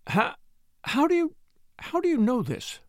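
high, trembling shock in the voice; frightened widow, lips shaking; quivering lips. A narrator's reading. Widow confronted about her husband, high and quivering. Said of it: "with quivering lips".

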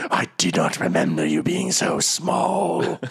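put-on looming creature voice; lightly voiced giant monster. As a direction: speak as a huge monster peering down. Game master voices the giant monster.

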